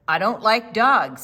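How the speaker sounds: loud grumpy refusal; the man refuses the dog, loud. Acted. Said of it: "said the man".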